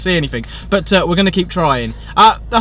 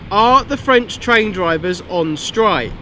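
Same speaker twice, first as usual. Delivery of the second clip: slow clear voice-assistant talk; 3 semitones higher, slower than usual. Real, not acted. Asking Siri on the phone.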